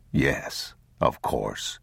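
low, slow, soft reassurance; calm a frightened child; soothing father. Acted.

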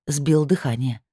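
ordinary delivery

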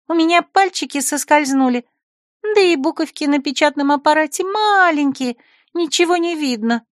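put-on high girlish excuse; whiny secretary. Secretary excuses herself to the rector, high and whiny. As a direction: make excuses like a spoiled girl. Lulu's voice.

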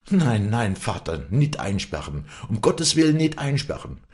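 high, urgent begging; desperate crying plea. A narrator's reading. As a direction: beg your father not to lock you up. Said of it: "schrie Walli auf".

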